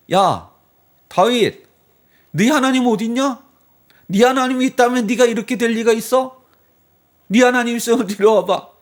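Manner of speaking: loud, high jeering at a beaten man; mocking taunt. Neighbours taunting David all day, loud high jeer. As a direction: sneer at David, daring his God to show up. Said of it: "주변 사람들이 뭐라 그래요?".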